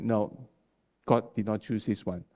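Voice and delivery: ordinary talk, nothing marked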